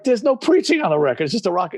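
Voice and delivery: high-pitched retold protest; offended. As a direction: push back like someone offended. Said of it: "And I got offended".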